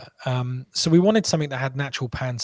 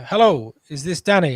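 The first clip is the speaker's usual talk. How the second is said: a bit higher and louder, lightly put-on caller voice; terrible Yoel impression; 4 semitones higher, 5 dB louder than usual. Founder mimicking a buyer's first phone call, badly faked caller voice. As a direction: sketch the New York buyer's greeting, hammed-up but thin. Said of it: "That's a terrible impression of Yoel".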